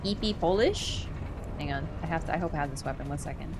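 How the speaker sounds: usual speaking voice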